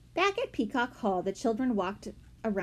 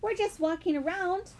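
high-pitched innocent explanation; polite; 10 semitones higher than usual. A narrator's reading. Henry explaining to the angry man, polite and high. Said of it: "Henry said politely".

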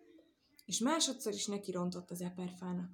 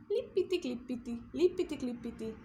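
higher, light hopping rhythm; bouncy hopping patter; 4 semitones higher than usual. A narrator's reading. Narrator mimics the rabbit's hopping, bouncy and light. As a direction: skip the syllables like little feet.

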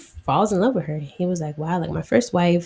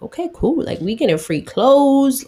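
high-pitched eager agreement, lightly acted; mock excitement, pitched up; excited guests; 8 semitones higher than usual. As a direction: imitate guests pleased at getting a freebie. Guests voiced as delighted by prize.

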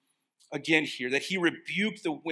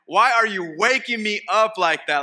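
loud, higher, hammed-up annoyed shout; pretend upset Jesus; 6 semitones higher, 10 dB louder than usual. Preacher as a woken Jesus snapping at disciples, mock-angry. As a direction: play Jesus grumpy at being woken. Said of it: "if I were Jesus, I'd be upset".

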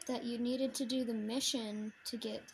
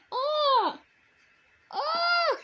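startled yelp; 10 dB louder than usual. Real, not acted. Startled by monster.

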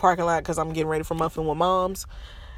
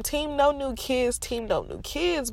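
higher, slower chant; playful slogan; 8 semitones higher, 4 dB quieter than usual. Real, not acted. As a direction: sing out the motto.

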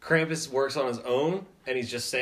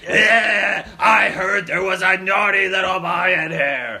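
loud, raised Krampus voice; menacing Krampus; 3 semitones higher, 8 dB louder than usual. Acted. Krampus confronting a naughty little boy, loud menace. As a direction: holler it like a monster bursting into the room.

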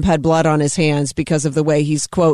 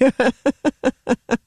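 amused laugh; 5 semitones higher than usual. Real, not acted. Host enjoying own jab at Biden, laughing. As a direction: run of laughter after a punchline.

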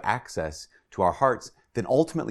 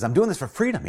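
William Wallace; 8 semitones higher than usual. Acted. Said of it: "And he says".

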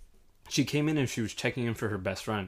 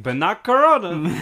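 loud, slow, high disbelief; incredulous retort; 7 semitones higher, 10 dB louder, slower than usual. Retold or imitated. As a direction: drag out Corona in mock disbelief. Mocking the Russians' virus denial.

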